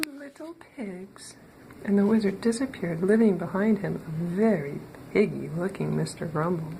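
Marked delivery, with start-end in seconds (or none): 0.1-1.4 high, soft and slow, drawn out; teasing threat. Acted. Wizard teasing Mister Grumble with a soft, high, slow threat. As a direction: savour the punchline like a playful threat.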